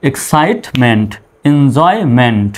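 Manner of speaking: "Excitement" and "enjoyment" drawn out slowly and clearly; slow model pronunciation. Shown as a demonstration. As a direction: read each word slowly, like a teacher modelling. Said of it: "এরকম হবে". (ধীরে ধীরে স্পষ্ট করে উচ্চারণ)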